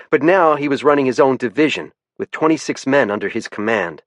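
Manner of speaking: ordinary delivery, nothing marked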